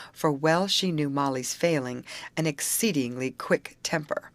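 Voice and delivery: usual speaking voice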